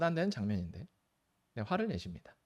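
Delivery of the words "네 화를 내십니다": normal talking voice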